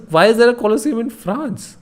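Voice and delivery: high, loud incredulous rise; baffled question. Real, not acted. Streamer stunned to find a Colosseum in France, loud disbelief. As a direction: ask it in disbelief.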